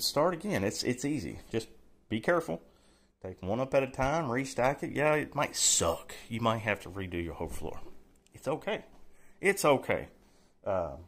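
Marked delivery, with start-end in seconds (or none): none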